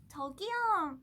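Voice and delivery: high-pitched aegyo call; cute version. Shown as a demonstration. Idol giving fans a cute call, high aegyo. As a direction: stretch it high and cutesy. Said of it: "큐티하게 저기".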